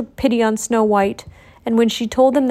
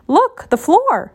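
flustered Doc; 6 semitones higher, much slower than usual. A narrator's reading. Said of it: "noted Doc".

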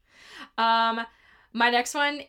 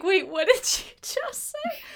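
disbelieving; 8 semitones higher, slower than usual. Retold or imitated. Reacting to an absurd line.

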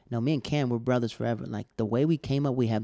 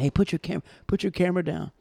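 higher, louder voice of a stern doorman; club staff; 8 semitones higher, 3 dB louder than usual. Retold or imitated. Club staff ordering a tall guest to stop photographing, stern.

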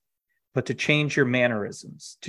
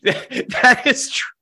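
laughing; 11 semitones higher, 5 dB louder, slower than usual. Real, not acted. Laughing at shared joke.

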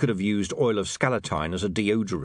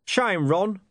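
commanding voice; 11 semitones higher, 3 dB louder than usual. A narrator's reading. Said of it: "commanded the voice of Deep Bone".

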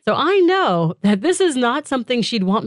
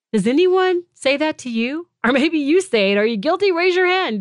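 ordinary delivery

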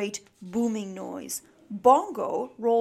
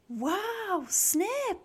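very high, airy wow; amazed; 10 semitones higher than usual. A narrator's reading. Max impressed by Snip's dad, amazed. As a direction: marvel at it.

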